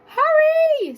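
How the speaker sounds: very high, loud squawk; squawking cockatoo. A narrator's reading. Cockatoo squawking a warning at the water. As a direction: squawk it urgently like a parrot. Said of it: "squawked the cockatoo".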